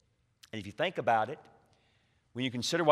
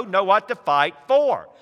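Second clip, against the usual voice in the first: ordinary talk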